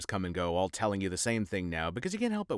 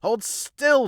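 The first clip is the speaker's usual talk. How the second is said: sharp high shout; shouted order; 13 semitones higher, 8 dB louder than usual. Acted. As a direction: bark the order loudly. Shouting at patient.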